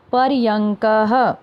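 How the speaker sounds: पर्यंकः very slowly, with "ng" and final "ha"; model pronunciation. Shown as a demonstration. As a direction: draw out par-yang-ka-ha in पर्यंकः. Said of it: "उनको भी उच्चारण करना है".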